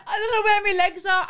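high, loud laughing panic; panicked call. Retold or imitated. Laughing at herself, calling the lads in panic.

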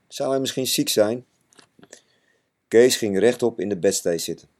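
usual speaking voice

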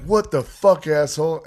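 high, loud mock outrage; imitating the guy who got bumped, angry and loud; furious stranger. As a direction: spit it like a hothead at a beer fest. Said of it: "And he's like".

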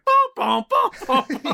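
mock piano thuds, sung high; piano bumping down stairs. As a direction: imitate a piano hitting each step. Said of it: "it's like".

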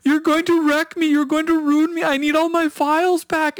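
high, wailing fake sob over lost files; mock crying. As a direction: whine it like a scam victim pretending to break down. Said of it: "The other guy starts to cry".

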